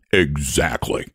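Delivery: ordinary delivery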